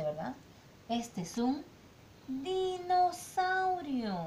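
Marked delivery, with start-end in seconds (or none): 2.3-4.1 stretched word. Shown as a demonstration. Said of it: "Dinosaurio con qué sílaba empieza".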